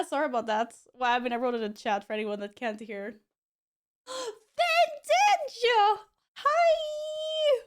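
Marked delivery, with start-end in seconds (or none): 4.1-5.6 gasping, high and excited; light up as you spot a friend. Real, not acted. Streamer spotting a returning viewer, gasping excitedly. Greeting a chatter with a gasp. 6.4-7.6 high, delighted greeting; excited hi. Real, not acted. Greeting a chatter with a gasp.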